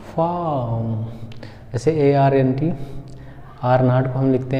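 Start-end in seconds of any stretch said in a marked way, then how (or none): 0.1-1.0 फार्म as slow "faaam"; stretched फार्म with no r sound; long vowel, silent r. Shown as a demonstration. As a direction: hold the vowel in फार्म, drop the r. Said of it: "क्या बोलेंगे?".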